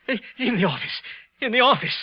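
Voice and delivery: frightened insisting. Acted.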